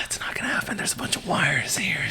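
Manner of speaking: hushed whisper aside. Real, not acted. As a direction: drop to a whisper. Quiet aside about wall wires.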